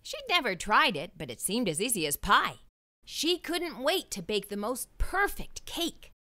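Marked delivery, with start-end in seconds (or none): none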